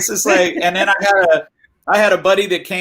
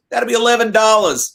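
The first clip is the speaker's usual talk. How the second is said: lightly voiced counter man, pitched up; retold seller's line, raised pitch; quoted seller; 6 semitones higher than usual. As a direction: announce the price like the seller, lightly imitated. Quotes the seller's surprisingly low price.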